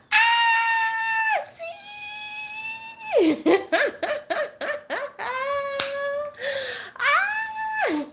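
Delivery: high, delighted shout of welcome; squealing joyful hello; excited shout. Real, not acted. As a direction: call out a happy greeting. Greeting a friend joining live.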